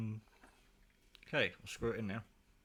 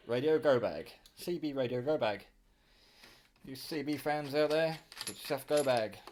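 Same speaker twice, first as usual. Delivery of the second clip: louder, punchy promo read for the bag; ad pitch; 3 semitones higher, 5 dB louder than usual. Acted. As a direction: sell it to the CB fans like a commercial. Closing sales pitch to viewers.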